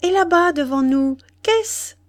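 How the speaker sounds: high, loud question; excited, lifted inquiry; eager questioning. A narrator's reading. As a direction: ask with lively curiosity. Julie eagerly asks about view.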